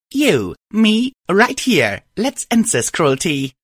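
high, loud and defiant; high defiant challenge. Acted. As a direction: call her out, high and firm. Narrator voices herself challenging Cruelty.

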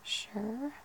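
ordinary delivery

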